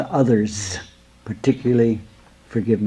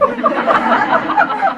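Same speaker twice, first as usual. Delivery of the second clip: high, gleeful rolling laugh imitated; guru's big laugh; 4 dB louder than usual. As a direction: laugh like the guru rolling on the floor. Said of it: "Then he laughed and rolled on the floor".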